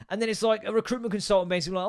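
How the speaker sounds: ordinary talk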